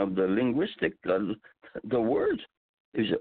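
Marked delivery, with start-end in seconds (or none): none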